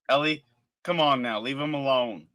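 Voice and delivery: calling out sharply; loud scolding. Real, not acted. Scolding someone off-mic.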